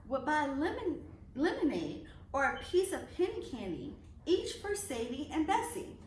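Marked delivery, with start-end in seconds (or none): none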